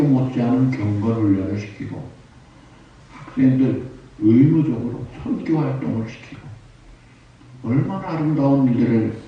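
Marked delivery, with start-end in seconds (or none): none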